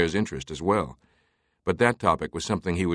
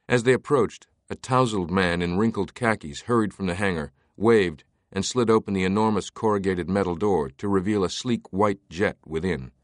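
ordinary delivery

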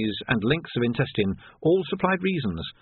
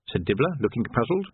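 plain reading voice

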